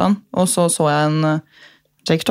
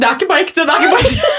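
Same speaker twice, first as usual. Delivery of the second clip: squeaky, rising self-reassurance; high-pitched insistence; 10 semitones higher, 3 dB louder, faster than usual. Real, not acted. Fan reminding tearful friends it's fiction, high-pitched and overwrought. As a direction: insist it, climbing much higher on the repeat.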